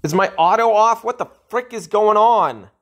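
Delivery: high, loud outraged questions; angry confusion. Real, not acted. Gamer yells at the game about what's happening, high and loud. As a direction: demand answers from the screen, high and loud.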